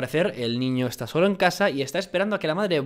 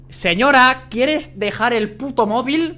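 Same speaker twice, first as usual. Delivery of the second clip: higher and louder, slow on the móvil line; exasperated yell; 8 semitones higher, 7 dB louder, slower than usual. Real, not acted. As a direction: shout at her to drop the phone. Yelling at the mother character. (grita harto a la madre que no suelta el móvil)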